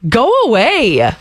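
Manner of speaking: slow, exhausted go away; weary groan. Retold or imitated. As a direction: drag it out like you're done. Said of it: "I'm like".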